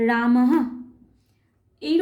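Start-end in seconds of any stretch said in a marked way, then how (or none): 0.1-0.5 রামঃ ends with a breathy h echo; visarga sound. Shown as a demonstration. Teacher demonstrating visarga at the end of রামঃ. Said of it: "তাহলে উচ্চারণ হবে".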